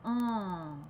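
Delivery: "En" nasal with the mouth open vertically; teacher demonstrating nasal "En"; nasal vowel. Shown as a demonstration. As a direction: sound "En" through the nose. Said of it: "avec la bouche verticale".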